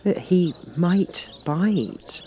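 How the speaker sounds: very slow, high, careful warning; cautious. A narrator's reading. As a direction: warn her off, carefully. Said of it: "Alice cautiously replied".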